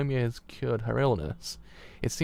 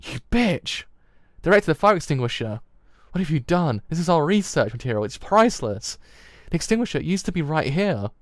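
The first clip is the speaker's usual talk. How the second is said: loud, raised-pitch outrage; frantic angry shouting; 4 semitones higher, 6 dB louder than usual. Acted. Doctor rages over burning research material, frantic angry shouting. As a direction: shout in a furious panic.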